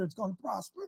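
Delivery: ordinary talk, nothing marked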